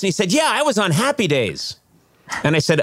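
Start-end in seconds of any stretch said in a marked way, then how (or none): 0.3-1.8 raised-pitch imitation of a proud old actor; proud bragging. Delivery man revealing his TV credit, mock proud.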